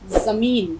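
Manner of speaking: زمین کو ز کی آواز سے، صاف ز کے ساتھ; correct pronunciation. Shown as a demonstration. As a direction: say زمین with a clear z sound, not j. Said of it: "آپ اس کو جمین نہیں پڑھیں گے، زیڈ کی آواز نکالیں گے". (زمین، ز کی صاف آواز کے ساتھ)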